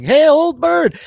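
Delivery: loud high yell. Retold or imitated. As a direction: yell across the field. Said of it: "Adam is, like, yelling".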